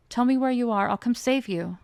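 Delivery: loud urgent call. Acted. Soren calls to the screaming Luca, loud urgent call. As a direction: shout to reach him.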